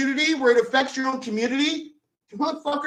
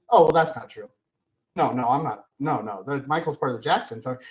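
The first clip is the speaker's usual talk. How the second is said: mock denial; 10 semitones lower, faster than usual. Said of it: "That's what he should have said".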